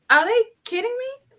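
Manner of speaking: can't believe it, voice shoots up; outraged disbelief. Retold or imitated. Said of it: "I'm like".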